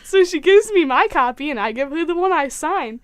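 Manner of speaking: usual speaking voice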